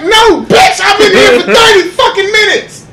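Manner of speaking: high loud shouted retort; hammed-up shout at the date; mock angry shout. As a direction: yell back at her in jest. Acted quarrel, his reply.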